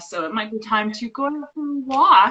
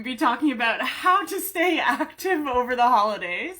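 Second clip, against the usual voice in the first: normal talking voice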